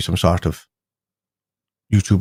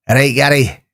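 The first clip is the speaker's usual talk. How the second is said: Glaswegian greeting, pitched higher; mock Glaswegian accent; 4 semitones higher, 3 dB louder than usual. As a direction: imitate a Glasgow local saying hello. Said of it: "sound like a right, uh, Glaswegian".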